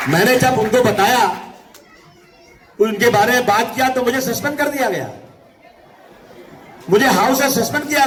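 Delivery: high-pitched, sustained political declamation; rally oratory. Real, not acted. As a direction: proclaim it to the whole crowd. (ऊंची आवाज़ में जोशीला भाषण)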